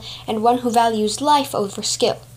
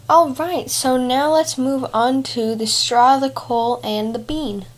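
ordinary delivery

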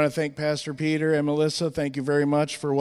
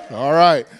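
ordinary talk, nothing marked

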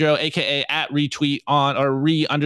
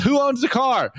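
higher, pointed question; the son pressing his dad; 8 semitones higher than usual. Acted. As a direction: son cornering the dad, pitched up. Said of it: "the son goes".

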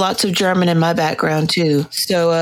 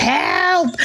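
cat voice; 10 semitones higher than usual. Retold or imitated.

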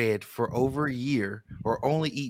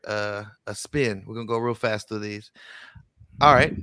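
usual speaking voice

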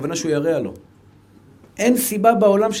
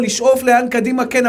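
normal talking voice

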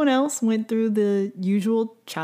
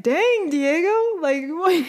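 shocked dang; 8 semitones higher than usual. Retold or imitated. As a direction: gasp at the insult, half laughing. Reacting to Diego's cruel insult.

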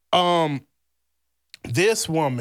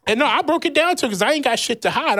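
usual speaking voice

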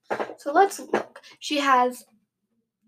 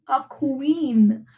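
normal talking voice

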